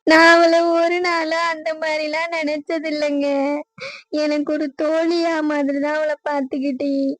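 tearful, wounded confession; crying. Acted. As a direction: break down in sobs while explaining. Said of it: "அழாத".

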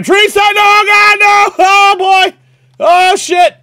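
real panic shout; panicked yelling. Real, not acted. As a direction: scream as the enemy charges. Panicking as enemy attacks.

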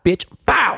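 high yelped slap noise "bow"; mock slap sound. Slapping the Anaheim guy, high cartoon slap yelp.

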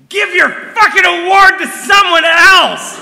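imitating angry yell. Director storming off, yelling back at the organizer. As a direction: bellow it as you storm out. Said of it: "and he goes".

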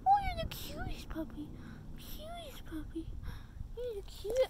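high cooing puppy talk. Real, not acted. Talking to the cute puppy, high cooing baby talk. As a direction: coo at the puppy.

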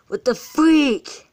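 high, loud, slow stunned cry; shocked exclamation. Real, not acted. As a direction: let the shock burst out, drawn out. Shocked at the card haul.

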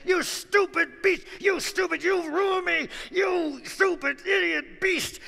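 high, loud enraged shouting at the ox; furious yelling. Acted. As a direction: scream insults at the beast. Farmer beats ox.

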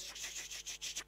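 breathy shh imitating boxes moving; soft shuffling shushes; whispered shuffle. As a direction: hush the sound of the shell game. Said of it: "He goes".

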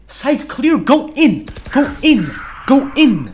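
loud, rising urgency calling teammates in; urgent callout. Real, not acted. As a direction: push them in, loud and urgent. Urgent team callout mid-round.

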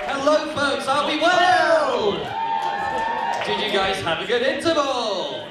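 loud, high crowd greeting; calling out to crowd. Real, not acted. Comic greeting the audience after the interval, loud. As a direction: rev up the audience.

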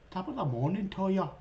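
quick, lightly put-on evil leprechaun. Gamer quoting the Leprechaun movie villain, lightly put-on evil leprechaun. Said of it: "evil leprechaun".

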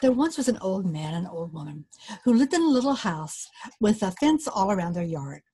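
plain reading voice

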